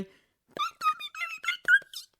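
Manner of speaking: very high, slow self-scolding; an elf dazed after hitting himself; muttering elf. A narrator's reading. Dobby scolding himself cross-eyed, high muttering.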